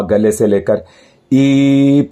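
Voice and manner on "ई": long, level ई held from the belly; drawn-out ई with effort; tense long vowel. Shown as a demonstration. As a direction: sustain ई firmly. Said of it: "फिर भी पेट आ गया इसमें".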